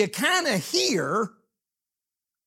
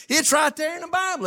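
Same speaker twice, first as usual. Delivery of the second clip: fast, high shout; preaching shout; 9 semitones higher, faster than usual. Acted. Answers the doubter, preaching.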